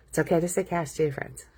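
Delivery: usual speaking voice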